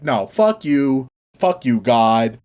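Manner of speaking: slow, low sulky refusal; mock-sullen defiance, each word drawn out; Jonah, pissed. Prophet refusing God's consolation, slow and sullen. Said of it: "Jonah's kinda pissed".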